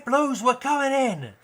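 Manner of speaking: high, slow radio call to the team; cop calling in. Acted. Cop calling the team over the radio, high and slow.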